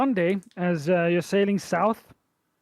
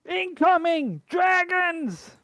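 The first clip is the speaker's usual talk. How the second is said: high, loud lookout's cry; shouting from crow's nest; 13 semitones higher, 4 dB louder, slower than usual. Acted. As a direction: yell the warning down from above. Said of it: "calls down from the crow's nest".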